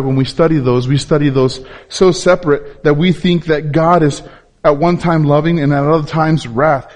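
ordinary delivery

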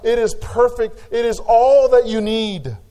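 a pastor thundering that grace is enough, full-force preaching; climactic preaching. Acted. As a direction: build the sermon to its peak.

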